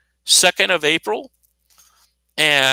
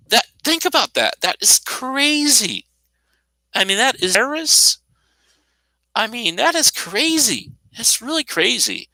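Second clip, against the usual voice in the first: indignant; 7 semitones higher than usual. Real, not acted. Outraged at the economic shutdown.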